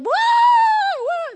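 high, long wailing whoop; mock mourners' wail. Preacher mimicking the crowd of mourners, mock wailing. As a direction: imitate mourners with a drawn-out high howl. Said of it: "they're just yelling".

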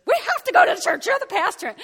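high, loud screaming at husband; wife screaming at her husband in the car; retold scream. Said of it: "And I'm screaming".

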